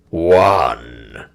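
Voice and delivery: low, flat counting voice; cold counting king. Acted. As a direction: count the dead like a cruel king. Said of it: "counted Twala the king".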